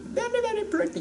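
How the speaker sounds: high, soft gushing; admiring. Real, not acted. Praising avocados to viewers, high soft gush. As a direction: gush over how pretty they are.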